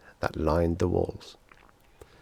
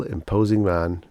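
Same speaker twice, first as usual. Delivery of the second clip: ordinary delivery, nothing marked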